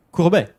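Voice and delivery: "Courbet" with a high, loud "BEH"; French pronunciation demo. Shown as a demonstration. Teacher finishing the breakdown for viewers, loud French "Courbet". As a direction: punch the final syllable of "Courbet". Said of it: "Listen very closely".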